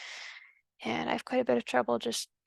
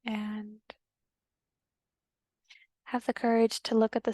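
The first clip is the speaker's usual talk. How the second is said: calm, unhurried meditation guide with long pauses; guided meditation. Acted. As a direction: gently guide them inward, leaving long silences between phrases.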